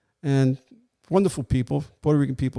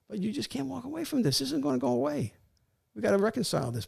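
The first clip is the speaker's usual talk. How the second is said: higher-pitched, pleading-firm retold appeal; insistent reasoning; 10 semitones higher, 4 dB quieter than usual. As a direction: press the point, voice raised in pitch. Officer retells urging man to surrender.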